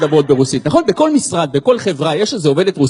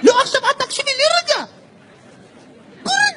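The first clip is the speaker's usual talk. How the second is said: very high, squeaky mock-annoyed phone voice; high-pitched imitation of an exasperated caller; irritated Ethiopian boyfriend; 5 dB quieter than usual. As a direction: nag your girlfriend in a tiny high-pitched voice. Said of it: "כי הוא כולו עצבני כזה".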